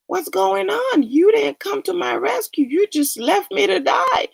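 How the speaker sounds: high, indignant complaint; accusing God. Retold or imitated. Dead man complaining to God, high and indignant. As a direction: raise the pitch and blame him for not coming.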